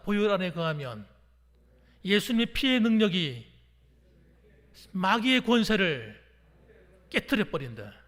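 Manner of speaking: loud declaration in short phrases; forceful chant-like prompting; call-and-repeat. Real, not acted. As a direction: lead the congregation, drawing out each phrase. Leads congregation to repeat after him.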